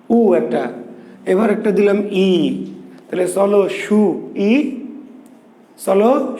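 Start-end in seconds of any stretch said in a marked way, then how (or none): 3.7-4.7 "সু … ই" slow, in two pieces; wrong split reading. Shown as a demonstration. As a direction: pause between সু and ই. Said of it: "না সু ই না".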